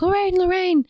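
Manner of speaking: high, urgent retold call. Ed banging on walls for his wife, calling out. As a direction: imitate a husband calling for his lost wife.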